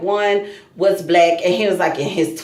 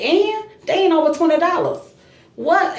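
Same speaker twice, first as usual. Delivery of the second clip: indignant retort; 7 semitones higher than usual. Retold or imitated. Retorting to accusing cashier.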